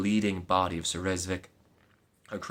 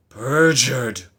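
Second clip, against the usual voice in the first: angry accusing cry; 3 semitones higher, 10 dB louder than usual. Acted. Knight accuses faithless maid.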